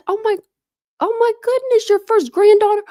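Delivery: high, loud delighted squeal over a new granddaughter; excited squeal. Real, not acted. Streamer squealing to a viewer about her granddaughter. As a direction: gush with real joy.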